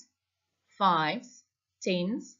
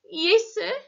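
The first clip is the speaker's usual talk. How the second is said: high, tight croaked reply; 10 semitones higher, 6 dB louder than usual. A narrator's reading. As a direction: force it out in a croak. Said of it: "he croaked".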